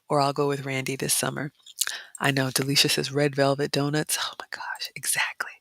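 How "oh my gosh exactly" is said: softer, eager whispered agreement; excited whisper. Real, not acted. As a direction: whisper it with delight. Whispered excitement over chat.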